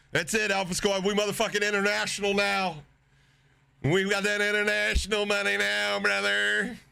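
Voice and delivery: rally the squad triumphantly; hyped celebration. Real, not acted. Celebrating international donation.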